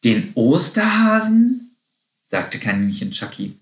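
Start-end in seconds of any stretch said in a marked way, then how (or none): 0.0-1.8 high, drawn-out question rising at the end; baffled question. A narrator's reading. Puzzled little rabbit answering Happy, baffled. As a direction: ask it in puzzled disbelief, high and slow. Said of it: "Die kleinen Nager schauen verdutzt".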